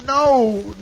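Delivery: a man cornered, denying fast; distressed denial. Acted. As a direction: blurt it out in a panic. Jim denies throwing at Mingo.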